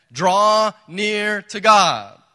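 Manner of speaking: loud, slow proclamation; preacher's ringing call; proclaimed command. Real, not acted. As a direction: boom out the command slowly.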